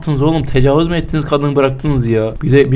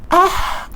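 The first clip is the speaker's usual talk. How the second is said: high startled ah; sudden high yelp; scared shout. Real, not acted. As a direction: cry out in fright. Near death scare.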